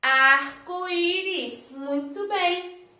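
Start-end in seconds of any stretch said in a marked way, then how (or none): 0.0-1.7 slow and louder, every syllable of "Arco-íris" pulled long; teacher naming the picture slowly for the class; stretched-out word demo. Shown as a demonstration. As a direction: spell it out by sound for small children. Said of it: "O que que é isso aqui?".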